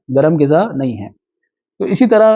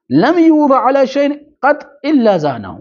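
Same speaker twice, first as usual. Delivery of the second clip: slow, lifted Arabic recitation; hadith recitation; 8 semitones higher, slower than usual. Acted. Teacher recites the hadith on gentleness, slow and raised. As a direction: raise the voice and draw out the Arabic phrase.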